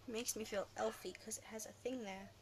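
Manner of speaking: unvoiced whisper, shy little confession; whispered aside. Real, not acted. Vlogger showing off her elf headband, hushed whisper. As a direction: whisper it like a secret about the headband.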